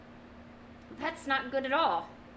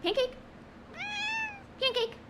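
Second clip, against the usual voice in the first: much higher sing-song call; calling the dog; 3 dB quieter than usual. Real, not acted. Calling her dog Pancake over, high coaxing call. Now you need to yell.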